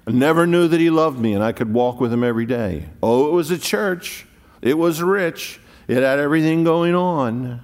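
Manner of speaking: loud, high, fast preaching; fervent preaching. Acted. Preacher rousing the church, loud and fast. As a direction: preach it out with fire to the congregation.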